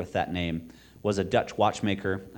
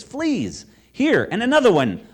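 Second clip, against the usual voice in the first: high, loud wail of dismay; 10 dB louder than usual. A narrator's reading. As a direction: cry out in distress at the bites. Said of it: "I wailed".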